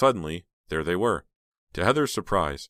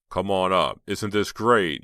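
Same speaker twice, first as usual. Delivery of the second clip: louder, calling up the hill to her; 3 semitones higher, 6 dB louder, slower than usual. A narrator's reading. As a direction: call her up to the top. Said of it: "he called".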